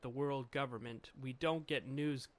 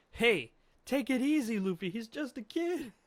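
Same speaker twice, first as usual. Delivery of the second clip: high, loud panicky warning; alarmed plea; 8 semitones higher, 7 dB louder than usual. Acted. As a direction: shout to hold him back. Acted alarm at Luffy hitting kid.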